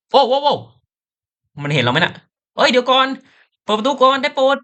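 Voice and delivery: panicked pleading. Acted. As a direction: beg them to open the door. Panicked character in chase. (ร้องขอให้เปิดประตูอย่างตื่นตระหนก)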